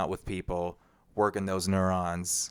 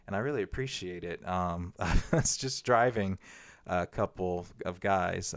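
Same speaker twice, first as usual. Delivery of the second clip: ordinary talk, nothing marked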